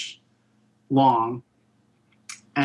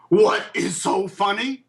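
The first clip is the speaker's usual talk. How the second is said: indignant higher-pitched demand; mock-indignant challenge, pitched up; mad, retold; 5 semitones higher than usual. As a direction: act out an offended star confronting someone laughing. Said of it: "he was really mad".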